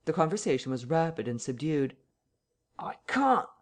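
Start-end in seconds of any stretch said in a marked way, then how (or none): 2.8-3.5 high-pitched, strained protest; voice rising. A narrator's reading. Mister Bunting refusing behind the door, voice rising. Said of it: "his voice rising".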